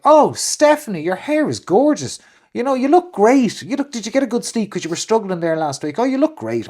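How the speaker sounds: high, gushing mock compliments; a lightly hammed-up gushing colleague; gushing woman. Woman colleague greeting Stephanie, high mock gush. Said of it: "she'll be like".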